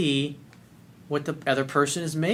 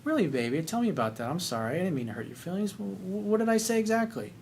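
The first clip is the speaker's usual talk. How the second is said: much softer, tender and soothing; gentle boyfriend; 3 semitones higher, 7 dB quieter than usual. Retold or imitated. Boyfriend soothing his hurt girlfriend, much softer and tender. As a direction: coax her softly, like a caring partner.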